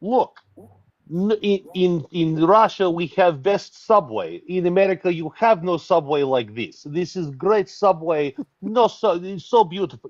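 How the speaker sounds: put-on high, heavy Russian accent, bragging. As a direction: boast about the subway like a Russian. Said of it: "I really wish he would have been like".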